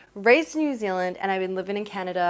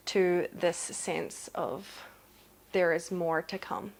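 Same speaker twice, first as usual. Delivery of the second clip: ordinary talk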